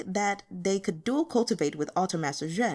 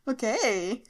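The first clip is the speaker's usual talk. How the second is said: laughing; 5 semitones higher than usual. Real, not acted. Reader laughs, collects self.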